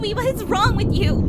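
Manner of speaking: high, loud outburst; angry shout. Acted. Aurora shouting at Louis in the fight, angry. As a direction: yell it at him in frustration.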